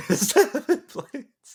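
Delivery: amused slow repeat of the joke; laughing disbelief. Real, not acted. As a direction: drag it out while cracking up. Laughing at seven blades joke.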